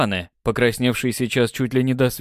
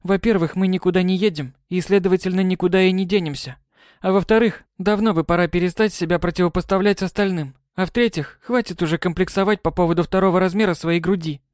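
put-on lecturing girl; 7 semitones higher than usual. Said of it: "заявила ей Май".

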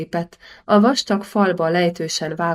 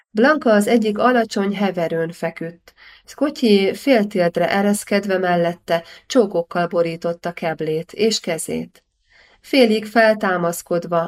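ordinary delivery

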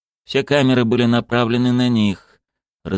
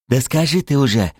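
raised, tense plea; tense urging; 6 semitones higher than usual. A narrator's reading. Kasim urges Zagir to speak, tensely. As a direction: push for it with mounting tension. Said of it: "напряжённо добавил Касим".

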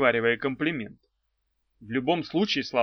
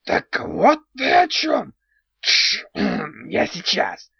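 high loud goblin cry; sudden goblin outburst; 12 semitones higher, 7 dB louder, slower than usual. A narrator's reading. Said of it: "вдруг сказал Антигон".

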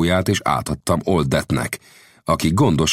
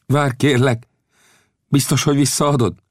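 higher, pleading voice; 9 semitones higher than usual. A narrator's reading. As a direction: beg him to wait. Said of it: "az indián könyörgő hangon megszólalt".

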